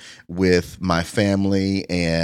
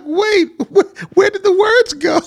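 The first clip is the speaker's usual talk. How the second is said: laughing; 7 dB louder than usual. Retold or imitated. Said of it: "I'm like".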